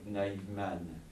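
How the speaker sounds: usual speaking voice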